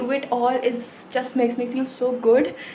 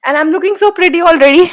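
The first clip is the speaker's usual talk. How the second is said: delighted self-praise; 7 semitones higher, 10 dB louder, slower than usual. Real, not acted. Admiring herself on birthday.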